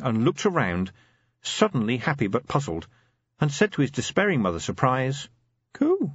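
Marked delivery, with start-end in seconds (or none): 4.6-6.1 put-on baby voice. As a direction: coo like a happy baby. Baby Sam speaking in the nursery.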